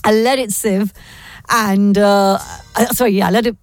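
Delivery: struggling through a failing voice, clearing the throat; voice going. Real, not acted. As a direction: voice giving out mid-sentence. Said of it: "my voice is going".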